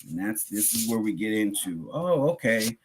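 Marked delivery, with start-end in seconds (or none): none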